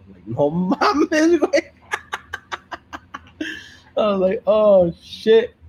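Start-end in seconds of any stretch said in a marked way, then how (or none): 0.3-1.7 loud, high squeal of disbelief; laughing disbelief. Retold or imitated. Retelling reaction to stomach-painting request, laughing incredulously. 1.9-3.9 helpless laughter. Real, not acted. Amused by own odd-request story, laughing helplessly. 4.0-5.6 loud, high retold shock; amused shock. Telling friends about the odd request, amused shock.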